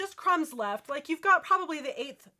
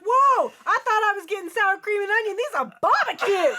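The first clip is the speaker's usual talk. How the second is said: higher, louder mock shock; surprised; 7 semitones higher, 7 dB louder than usual. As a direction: react like you've been tricked. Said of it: "You're like".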